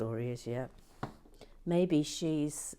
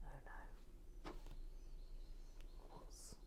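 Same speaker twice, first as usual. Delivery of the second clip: soft whispered fretting over a mistake; real whispered worry; 3 semitones lower, 16 dB quieter than usual. Real, not acted. Fixing a mistake in the build, whispering to himself. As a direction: mutter to yourself under your breath.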